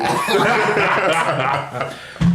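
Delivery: high burst of laughter; laughing hard. Real, not acted. Laughing at the story.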